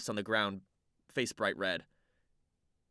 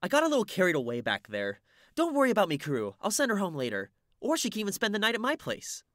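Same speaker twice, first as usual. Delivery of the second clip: put-on cheerful girl voice; 11 semitones higher, 5 dB louder than usual.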